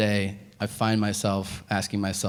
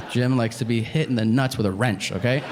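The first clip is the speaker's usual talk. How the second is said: ordinary delivery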